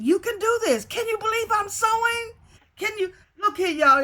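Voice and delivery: high, thrilled squeal; bursting with pride at herself; excited. Real, not acted. Thrilled at learning to sew.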